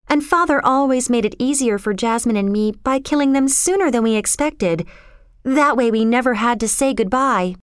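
put-on airy, untroubled girl character; matter-of-fact young girl. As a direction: explain horrors lightly in a high girl's voice.

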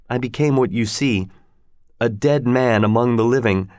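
ordinary delivery, nothing marked

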